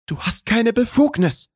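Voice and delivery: high-pitched indignant female character voice; angry. A narrator's reading. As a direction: protest angrily, pitched far up without shouting. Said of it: "knallte die Mappe wütend". (wütend empörte, hohe Frauenstimme)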